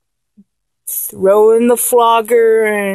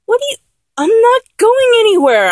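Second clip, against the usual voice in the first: startled reassurance; 12 semitones higher, faster than usual. Acted. Partner panics under the blindfold.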